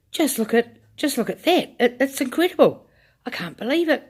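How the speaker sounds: put-on boy shouting in wonder; excited shouting. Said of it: "he was shouting".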